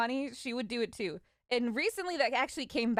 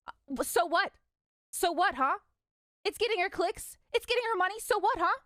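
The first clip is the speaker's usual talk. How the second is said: mom defending; 8 semitones higher than usual. Retold or imitated. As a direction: tell dad off in her defence. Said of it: "my mom like beat his ass".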